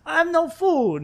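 high, slow drawn-out mock wail; wailing roommate. Roommate imitating her complaint to police, high mock wail. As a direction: stretch the complaint out in a high mimicking voice.

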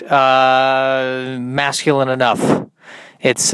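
ordinary delivery

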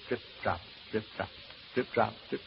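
low, soft, spaced-out echoing of drops; hushed counting drips. Acted. Man watching the ceiling stain drip, hushed. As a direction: mark each drop softly as it falls.

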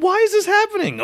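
put-on squeaky, alarmed boy voice; high panicked kid voice. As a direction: play a startled teen boy. Voicing a scared kid.

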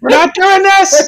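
very high, loud squeal; shrill comic refusal; mock panic. Mock panic about pregnancy.

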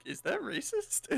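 slow, very high, laughing; mock innocent question. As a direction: ask it in a squeaky innocent voice. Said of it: "then we're like".

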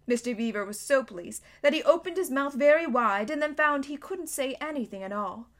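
usual speaking voice

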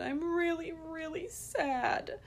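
put-on high, slow, small sad child voice; a little boy admitting he is sad; sad toddler. Son telling mom how he feels, high, slow and sad. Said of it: "He said".

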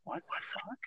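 scared whisper under breath; whispered fright. Retold or imitated. Whispering to himself as the stranger steps closer, scared. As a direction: breathe it out, spooked. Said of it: "I get kinda, like, scared".

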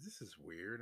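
soft private remark; saying to oneself. Retold or imitated. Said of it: "I'm saying to myself".

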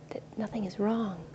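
unvoiced, hushed; whisper. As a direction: breathe it as a secret. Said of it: "reassuring the worried part".

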